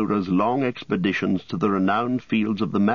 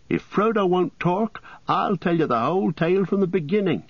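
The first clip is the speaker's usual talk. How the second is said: lightly raised, chatty hobbit voice; lively hobbit Pippin, eager to talk; 10 semitones higher than usual. A narrator's reading. Pippin offering Merry the whole tale, eager. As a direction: jump in and take over the story.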